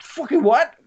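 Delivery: a sudden high squawk of surprise; shocked yelp. Retold or imitated. Dwarves caught out, shrieking in surprise. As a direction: shriek the question in disbelief. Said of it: "And they're like".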